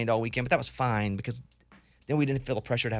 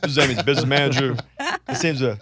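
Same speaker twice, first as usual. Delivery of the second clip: put-on phone-caller voice, louder and pushed; hammed-up fake manager making a call; fake business manager voice; 5 dB louder than usual. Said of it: "call with a fake voice".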